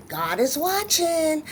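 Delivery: high, drawn-out motherly reminder; mother's reminder. Retold or imitated. Mama reminding her daughter, high motherly warning. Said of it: "she will always say".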